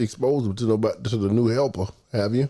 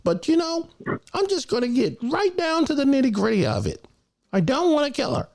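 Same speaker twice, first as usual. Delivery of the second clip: much higher put-on voice for the caller. Imitating Royalty asking for a dog, much higher voice. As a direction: pitch it way up like the guy on the line. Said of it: "he hit the button and said".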